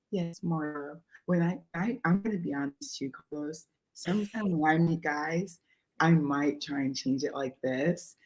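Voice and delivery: low, very soft, measured voice; changed dating voice. Shown as a demonstration. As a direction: drop it low and soft to charm a date. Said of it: "Should I start talking like this?".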